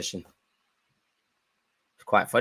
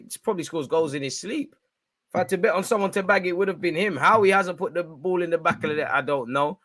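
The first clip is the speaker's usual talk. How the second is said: usual speaking voice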